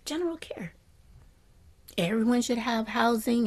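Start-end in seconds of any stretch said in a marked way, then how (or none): 0.1-0.7 softly whispered General care. Shown as a demonstration. Teacher repeating General care to the class in a whisper. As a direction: breathe General care as a hush. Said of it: "Just general care".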